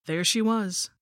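usual speaking voice

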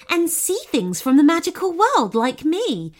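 a hint of an inviting storybook goblin; lightly voiced goblin. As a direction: lightly play a hospitable goblin, lifted voice. Narrator voices friendly goblin.

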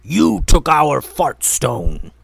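loud, slow troll bellow; furious troll booming at the archer; angry troll yell. A narrator's reading. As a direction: roar the accusation like a dim cave troll. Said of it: "yelled one of the trolls".

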